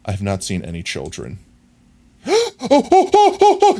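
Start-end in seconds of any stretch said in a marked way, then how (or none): 2.3-3.8 high squealing gasps of delight; excited gasping oh. Real, not acted. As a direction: gasp and squeal at the rare find. Gasps at rare boy jockey.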